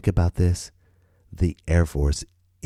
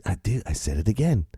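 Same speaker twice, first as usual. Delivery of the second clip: ordinary talk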